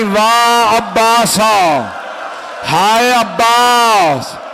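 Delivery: drawn-out grieving wail; mother mourning her son, slow keening cry; lamenting wail. Acted. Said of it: "मातम करने लगी".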